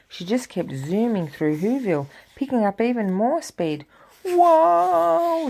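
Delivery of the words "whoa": high, loud drawn-out whoa; frightened cry. Acted. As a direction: cry out as you speed up. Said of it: "Cindy Lou cried".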